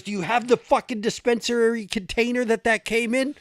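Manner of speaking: high, shouted interrogation in imitation; trooper losing it. Trooper questions driver about the weed's container, shouting. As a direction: holler the question as the raging trooper. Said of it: "Fucking loses it".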